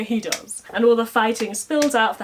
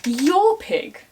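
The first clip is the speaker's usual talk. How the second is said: high, loud indignant; outraged disbelief; 11 semitones higher, 6 dB louder than usual. Retold or imitated. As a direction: spit it back in outrage. Kit outraged at Conall's claim.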